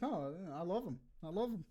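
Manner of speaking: put-on Australian accent. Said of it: "just like in Australia".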